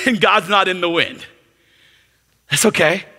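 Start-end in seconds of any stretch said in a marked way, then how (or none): none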